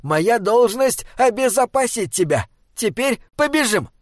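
earnest, hurried troll guardian; urgent little troll. Acted. Troll's figure voice in narration.

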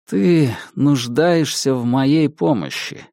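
ordinary delivery

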